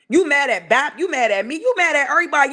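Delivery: ordinary talk, nothing marked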